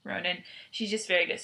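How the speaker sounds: usual speaking voice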